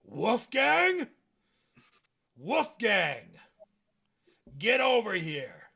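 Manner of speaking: big booming call across the factory. Acted. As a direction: bellow the name, loud and high. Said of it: "you hear a big booming voice".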